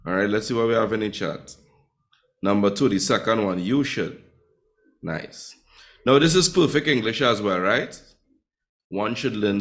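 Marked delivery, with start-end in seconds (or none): none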